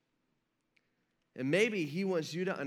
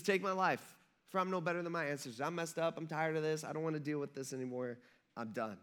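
acted depression; 2 semitones lower, 6 dB quieter, faster than usual. Preacher voices depressed Elijah giving up, acted resignation. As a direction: give up softly, sinking away by the end. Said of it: "Talk about depressed".

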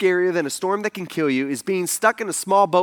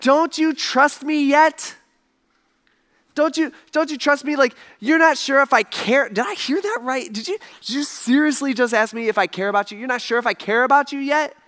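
incredulous challenge; 5 semitones higher than usual. Retold or imitated. Jesus challenging disciples' doubt of him.

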